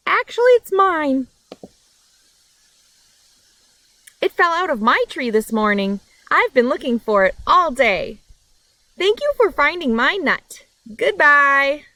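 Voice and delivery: chirpy new character taking back her nut; squirrel owner. Acted.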